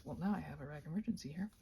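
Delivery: ordinary talk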